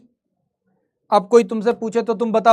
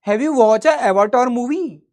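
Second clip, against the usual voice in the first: mock anglicised question, slowed and drawn out; mocking English-copying Indians; 2 semitones higher, much slower than usual. Teacher mocking Indians who copy the English, affected English question. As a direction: imitate a show-off copying the English, stretching each word. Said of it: "हर जगह जाकर बोल रहे हैं".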